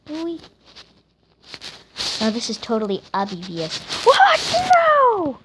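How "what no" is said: high, loud shriek of disbelief; shocked outburst. Real, not acted. Gamer reacting to a sudden fall, shocked yell. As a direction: cry out, pitch leaping way up. Falls in the game.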